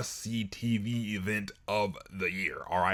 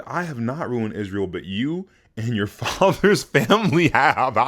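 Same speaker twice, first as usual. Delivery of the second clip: laughing comeback; 9 dB louder than usual. Retold or imitated.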